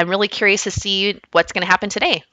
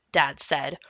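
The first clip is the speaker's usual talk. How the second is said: ordinary delivery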